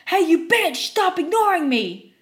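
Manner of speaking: enraged customer screaming; angry yell. Retold or imitated. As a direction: yell like you're being ignored.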